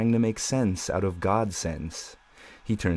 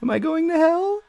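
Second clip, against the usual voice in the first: very high, small frightened question; tiny voice; 3 dB louder than usual. Acted. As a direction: ask it like a scared child. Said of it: "his own tiny voice say".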